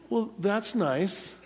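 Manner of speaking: soft, slow and unenthused; unimpressed. Retold or imitated. Preacher doubts coworker's news, soft unimpressed reply. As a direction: brush it off coolly. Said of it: "That's sort of how I said it".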